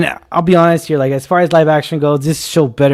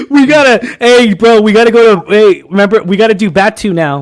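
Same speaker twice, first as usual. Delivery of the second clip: loud, high jokey bro voice; hammed-up dude calling his buddy; mock bro; 5 semitones higher, 5 dB louder than usual. Friend doing a hammed-up bro voice to tease.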